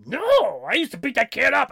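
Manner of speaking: high, loud, strained yell; mock bully. Retelling a Bobcat bit, shouting as the bully. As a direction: bark it out like a gleeful bully.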